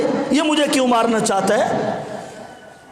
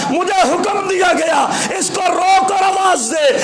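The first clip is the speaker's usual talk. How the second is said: preaching at full force; 6 semitones higher than usual. Acted. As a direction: proclaim it to the crowd, voice raised high. Rider's speech preached at full force. (آسمانی سوار کی زبانی پرجوش، بلند خطابت)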